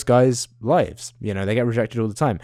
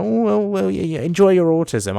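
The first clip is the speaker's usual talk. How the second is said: mock groveling jester; 9 semitones higher than usual. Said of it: "like the court jester, groveling".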